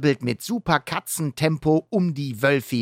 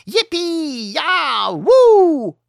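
put-on high whooping wolf-kid howl; 7 dB louder, much slower than usual. Wolf kids bursting from a hole, howling loud. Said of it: "heulen sie".

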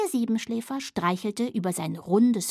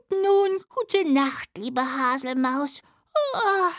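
slow, yawning little dormouse; drowsy goodnight ending in a yawn; sleepy child; 6 semitones higher than usual. Acted. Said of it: "gähnte der kleine Siebenschläfer". (schläfrig, mit Gähnen)

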